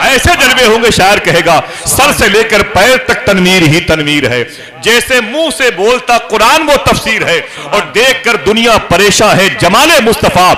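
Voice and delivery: high, loud impassioned recitation; a soaring, shouted verse; fiery preaching climax. Acted. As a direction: build it to a roaring peak.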